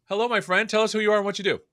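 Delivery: usual speaking voice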